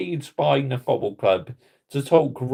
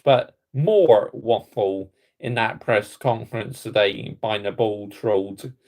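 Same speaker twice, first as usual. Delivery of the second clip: ordinary delivery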